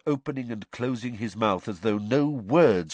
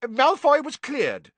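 high, loud, offended; affronted Fudge; 6 dB louder than usual. A narrator's reading. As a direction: bristle at the accusation. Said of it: "visibly affronted".

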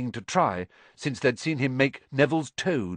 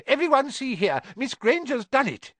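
high, squeaky eager voice; squeaky professor; 12 semitones higher than usual. A narrator's reading. Flitwick calling the class to look, high squeaky pride. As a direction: show off the pupil proudly.

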